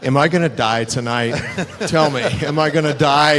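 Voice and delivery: loud, laughing mock panic, pitch rising; hammed-up fear of dying tonight, half laughing; mock terrified asking. Wrestler asking Harley if he'll survive Vader, mock terror.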